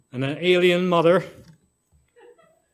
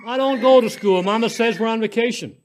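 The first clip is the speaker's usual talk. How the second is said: put-on high, louder little girl; chattering child; 6 semitones higher, 5 dB louder than usual. A narrator's reading. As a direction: explain brightly like a child. Child explains.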